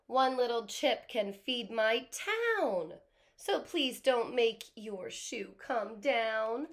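loud, slow, indignant ant; protest, then plead. A narrator's reading. Teacher voicing the ant denying being a crook, loud. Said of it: "The ant says".

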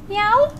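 high, loud meow; high cat meow. Acted. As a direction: mew like a small kitten. Imitating the cat.

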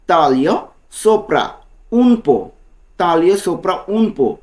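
very slow "Taglio sopra", both words stretched; slow model phrase. Shown as a demonstration. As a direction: spell it out for the learner. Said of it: "এর Italian কী হবে?".

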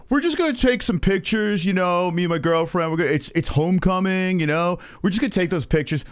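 whiny-rising sideways-mouth kid imitation; mock yuppie kid. Kid excusing himself to the angry driver, mocking sideways-mouth yuppie voice. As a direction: talk out the side of the mouth, mocking him. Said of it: "With the sideways mouth, you know, when the fucking yuppie kids".

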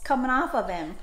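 usual speaking voice

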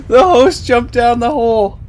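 loud, high, real laughing fit; cracking up. Real, not acted. Gamer reacting to the host jumping down the hole, real helpless laughter. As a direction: burst out laughing and talk through it.

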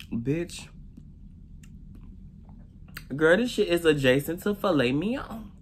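talking with mouth full; savoring a bite; chewing food. Real, not acted. Seen 5: Holds fried chicken, chewing expressions, bites it around 10s.

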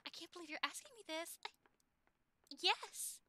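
high, halting, mock-flustered voice; hammed-up swooning surprise, with pauses; overcome girlfriend. Girlfriend answering her boyfriend's proposal, flustered and thrilled.

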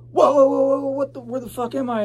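high, loud, panicked whoas, then fast; waking up somewhere strange, alarmed; startled. Retold or imitated. Waking on a stranger's couch, a startled panicked cry. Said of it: "and I was like".

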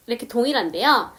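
ordinary delivery

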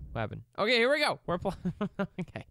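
ordinary talk

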